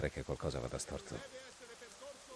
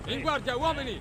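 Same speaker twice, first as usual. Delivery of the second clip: shouted order; 5 dB louder than usual. Acted. Commanding troops in battle.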